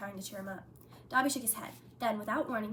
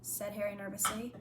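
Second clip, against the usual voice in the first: ordinary delivery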